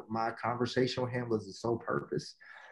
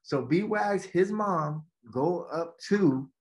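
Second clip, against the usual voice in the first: usual speaking voice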